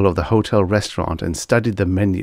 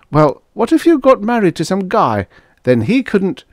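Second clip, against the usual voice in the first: lightly voiced young woman, higher; a helpful young waitress, raised voice; 13 semitones higher, 4 dB louder than usual. Girl character voice.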